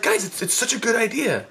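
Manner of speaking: high-pitched, earnest pleading; insistent. Retold or imitated. Teen convincing doubtful friends, high and insistent. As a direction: overeager self-defense.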